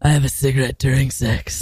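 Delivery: low, smoky late-night DJ voice; sexy radio voice. Acted. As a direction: purr it like a radio host. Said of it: "This is our sexy radio voices".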